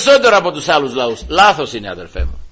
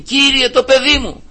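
much higher, slow, persistent begging; insistent plea; 8 semitones higher than usual. Retold or imitated. Woman insisting to Christ about her child, high plea. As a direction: keep pleading without giving up. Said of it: "συνέχιζε να επιμένει".

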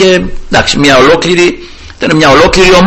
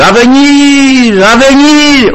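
high, long-drawn call across a distance; 10 semitones higher, much slower than usual. Retold or imitated. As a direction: call the name from far off, stretching the last syllable. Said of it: "άρχισε να φωνάζει ο Δαβίδ". (μακρόσυρτο φώναγμα από μακριά)

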